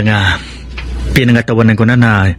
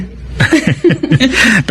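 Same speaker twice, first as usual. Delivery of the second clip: hearty laugh; 13 semitones higher than usual. Acted. Suitor laughs at teasing.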